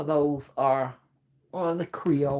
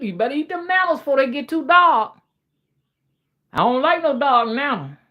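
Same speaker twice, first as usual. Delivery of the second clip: loud, higher Geechee dialect; Geechee dad; 8 semitones higher, 6 dB louder, faster than usual. Acted. Dad hurrying his girl to eat, loud Geechee dialect. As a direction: call it out like a Geechee father. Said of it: "this dialect was very geechee".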